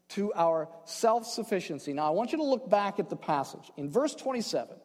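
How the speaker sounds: ordinary delivery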